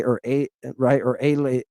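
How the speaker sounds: ordinary delivery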